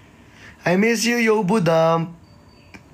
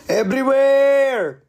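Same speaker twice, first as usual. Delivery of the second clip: excited shout; 7 semitones higher, 4 dB louder, slower than usual. Real, not acted.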